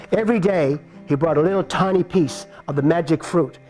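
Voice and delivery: ordinary delivery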